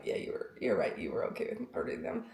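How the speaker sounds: low, unvoiced guilty whisper; sheepish whisper. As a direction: concede it under your breath. Sheepish admission to husband.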